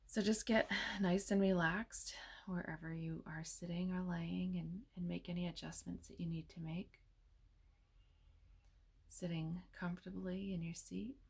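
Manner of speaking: soft, unhurried meditation voice with long pauses; hushed, soothing delivery, leaving space between phrases; guided meditation. Real, not acted.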